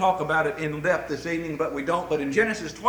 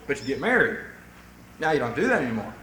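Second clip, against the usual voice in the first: ordinary delivery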